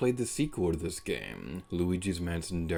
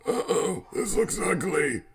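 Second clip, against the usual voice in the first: lightly voiced old man professor; a hint of a doddering old scientist; 10 semitones higher, 4 dB louder than usual.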